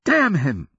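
loud, high curse; high cry of fury and panic; outraged cry. A narrator's reading. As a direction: burst out in outrage. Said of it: "her voice loud enough to draw looks".

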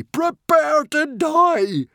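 very high, loud, slow death sentence; villainous cry. Acted. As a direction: hurl the threat down at the boy. Norbert pronounces doom.

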